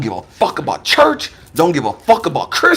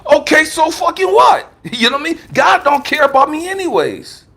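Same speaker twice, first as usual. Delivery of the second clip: defiant retort; 9 semitones higher than usual. Retold or imitated. Defiant reply to family's hell warning.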